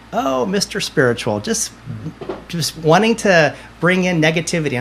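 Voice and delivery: imitating friends poking fun; joking mockery. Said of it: "they're joking with me".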